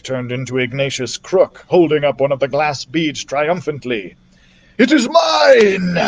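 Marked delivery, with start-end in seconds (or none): 4.8-6.0 slow, high, loud triumphant shout; villain's cry. Acted. Ignatius Crook gloats in Daniel's dream, slow triumphant villain shout. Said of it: "cried Ignatius in my dream".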